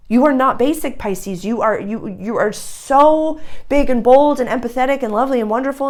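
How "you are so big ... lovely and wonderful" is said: gushing praise. Real, not acted.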